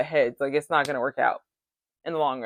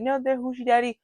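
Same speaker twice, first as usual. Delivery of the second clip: higher-pitched, rattled-off repeated catchphrase; insistent repetition; 6 semitones higher than usual. Retold or imitated. Castmate who won't stop saying it, insistent mimicking. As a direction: imitate her repeating it over and over. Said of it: "she said it, like, twenty times".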